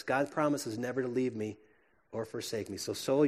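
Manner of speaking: ordinary talk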